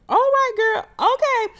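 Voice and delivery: very high squeaky falsetto, humoring her; mock acceptance. Host humoring a housewife's dubious story, mock acceptance. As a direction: squeal it like you don't believe a word.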